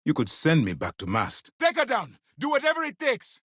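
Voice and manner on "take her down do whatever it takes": very high, urgent command; urgent shouted order. Acted. Commander ordering crew to take a ship down, urgent shout. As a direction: shout the order out across the bridge.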